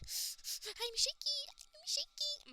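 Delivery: put-on high, slow flustered girl voice with a gasp; flustered Yuri. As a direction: squeak it out in a fluster.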